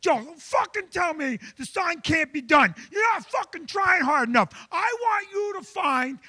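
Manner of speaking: high, shouted angry outburst; furious boss rant. Retold or imitated. Boss exploding at his sign guy in a meeting, shouting high. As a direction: explode at the employee, pitched high. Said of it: "and he goes".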